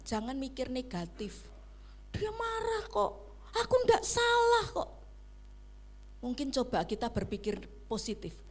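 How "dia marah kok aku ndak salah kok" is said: suara tinggi, membela diri; negative thinker. Retold or imitated. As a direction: ucapkan seperti orang yang merasa benar sendiri. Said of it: "Jadi intonasinya tuh seperti itu". (meniru orang berpikir negatif dengan nada tinggi)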